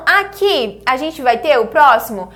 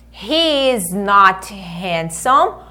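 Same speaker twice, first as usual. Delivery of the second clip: slow, deliberate full form; slow model sentence; 4 semitones lower, much slower than usual. Shown as a demonstration. Teacher showing the full form slowly to the class. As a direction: say each word slowly and apart. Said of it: "tanto faz se eu falar".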